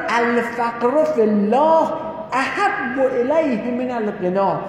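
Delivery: slow, measured Arabic quotation; hadith recitation. Acted. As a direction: lay out each Arabic word with weight. (قرائت آرام و شمرده حدیث)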